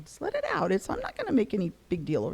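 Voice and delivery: high, soft urging; hushed little nudge to open up; gentle coaxing. Retold or imitated. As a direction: coax a child tenderly and quietly. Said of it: "You have to coax it up".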